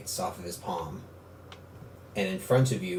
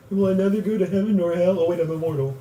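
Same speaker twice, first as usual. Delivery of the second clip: fast mock panic; 8 semitones higher, faster than usual. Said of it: "You're just like".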